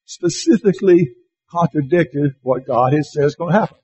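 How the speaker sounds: ordinary delivery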